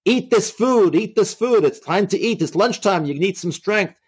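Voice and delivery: high-pitched mock yell, pushing orders; retold yelling. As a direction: imitate guards yelling orders without full volume.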